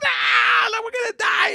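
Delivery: high, loud terrified yell; panicked scream. Retold or imitated. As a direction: scream that you're about to die. Panicking on ride.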